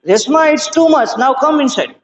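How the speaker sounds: understated storybook shout; mild narrated shout. A narrator's reading. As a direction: lightly voice a mother calling her child in. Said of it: "shouted the mother".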